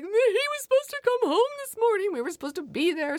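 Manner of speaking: retelling a sob, voice breaking high; tearful retold wail. Mother tells wife of her missed pickup, crying. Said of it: "I'm like, ... burst into tears".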